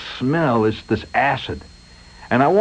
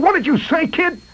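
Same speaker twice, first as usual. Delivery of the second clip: stunned foreman. Retold or imitated. Riley stunned.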